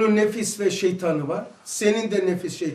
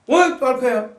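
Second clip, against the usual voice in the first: loud shooing yell; angry imam shouting; 4 semitones higher, 7 dB louder than usual. Retold or imitated. Imam driving children to back rows, loud yell. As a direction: shoo them to the back. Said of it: "Çocuklara nasıl kızıyor camide?".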